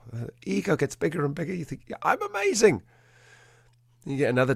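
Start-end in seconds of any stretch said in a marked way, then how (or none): 1.9-2.8 much higher, mock-smug self-congratulation; smug self-praise. Inner voice of a trainer whose ego swells, hammed-up smug. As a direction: puff yourself up like the best dog trainer alive.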